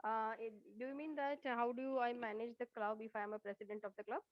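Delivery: faint, far from the mic; barely audible. Real, not acted. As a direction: voice too low to hear. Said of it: "Your voice is very low".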